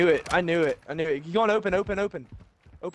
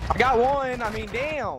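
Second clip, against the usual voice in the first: excited shout; 10 semitones higher, 5 dB louder, much slower than usual. Real, not acted. Excited kill callout.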